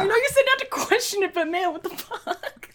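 high and loud, laughing through the words; cracking up in disbelief; laughing incredulous. Real, not acted. Laughing at the fanfic reveal.

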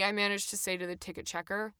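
ordinary delivery

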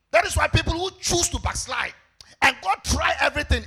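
full-voiced pulpit exhortation; forceful preaching. Real, not acted. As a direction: preach it out, hammering each phrase.

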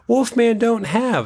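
put-on high, slow kid line; drawn-out high kid voice. Quoting the trailer, mimicking a kid. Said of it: "another character going".

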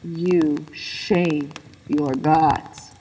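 very slow, low, otherworldly rebuke; dark spirit voice. Acted. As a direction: pronounce judgment like a cold god. Dark spirit rebukes the old man.

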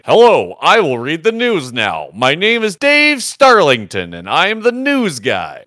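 loud, high-pitched old radio announcer impression; mock Atlantic radio accent, projected loudly; BBC newsreader. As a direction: put on a big BBC news-guy voice. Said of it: "That's the B B C accent".

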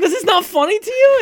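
high, squeaky disbelief, retold; incredulous. Telling co-host how he challenged her, incredulous. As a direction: re-enact a baffled question way up high.

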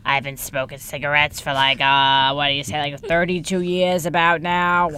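low, slow put-on smoker voice; chain smoker. Teen pretending to podcast as a chain smoker, low put-on voice. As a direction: play a weary longtime smoker. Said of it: "being, like, chain smokers".

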